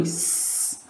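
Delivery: "S" as a long soft hiss, not the letter name; hissed S sound. Shown as a demonstration. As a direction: make the noise of "S", sssss. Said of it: "il fait déjà le bruit".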